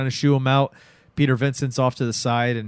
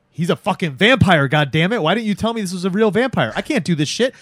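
loud, high, acted indignation; panicked outburst; 8 semitones higher, 5 dB louder than usual. Actor fleeing a real vampire, panicked and indignant. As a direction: protest loudly and high as you bail out.

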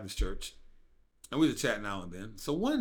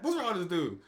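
exasperated; 7 semitones higher than usual. Retold or imitated. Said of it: "I mean, just like that".

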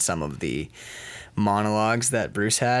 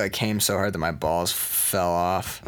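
usual speaking voice

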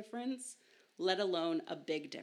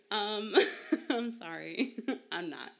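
laughing; 4 semitones higher, 7 dB louder than usual. Real, not acted. Laughs at own joke.

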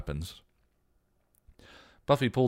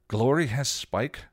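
slow, higher shocked question; gasping; 8 semitones higher, 6 dB louder, slower than usual. A narrator's reading. Buffy hears Glory took Spike, shocked. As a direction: let the shock land slowly. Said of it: "Buffy gasped".

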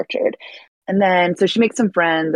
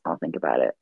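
put-on low vampire boyfriend; 5 dB quieter, much faster than usual. Edward voiced in movie summary.

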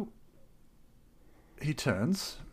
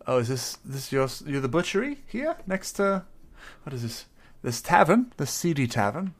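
polite adventurer; 7 semitones higher, 9 dB louder than usual. Acted. Player voices his character.

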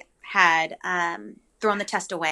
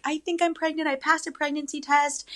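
high, soft nervous admission; a higher, hushed worried voice; anxious confession; 8 semitones higher, 5 dB quieter than usual. Retold or imitated. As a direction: sound small and rattled on the phone. Panicked call to pastor's wife.

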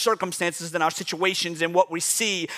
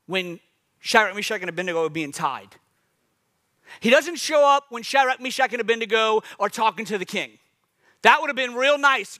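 ordinary delivery

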